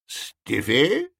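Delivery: slow, questioning echo of a name; puzzled old gentleman. A narrator's reading. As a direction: repeat the name in baffled disbelief.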